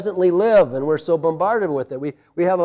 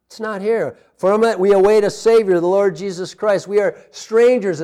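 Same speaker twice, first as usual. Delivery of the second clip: usual speaking voice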